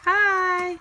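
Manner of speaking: high bright greeting demo; sing-song hi. Shown as a demonstration. Mom modelling hi for toddler, high sing-song. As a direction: lift it up high for the child. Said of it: "can you say hi?".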